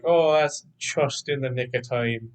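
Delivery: light Scottish accent, "just" said as "chust"; a hint of Scottish brogue, "just" turned to "chust". Shown as a demonstration. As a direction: read it in a mild Scottish lilt, "just" with a ch sound. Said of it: "With a Scottish accent".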